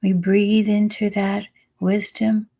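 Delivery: unhurried meditation voice with long pauses; calm, spacious meditation guiding; guided meditation. Acted. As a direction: lead them into the breath, phrase by phrase. Leads a breathing meditation.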